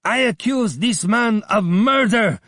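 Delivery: high, loud screech of a hateful man; vulture-like Abdullah screeching; screeching accuser. A narrator's reading. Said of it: "he screeched".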